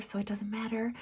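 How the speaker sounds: drops to a breathy aside whisper. Real, not acted. Whispering to viewers that the lighting doesn't matter. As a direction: toss it off in a whisper.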